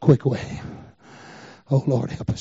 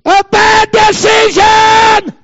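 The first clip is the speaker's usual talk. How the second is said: preaching shout; 5 dB louder, much slower than usual. Real, not acted. Preacher repeats the warning phrase.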